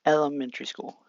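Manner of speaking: usual speaking voice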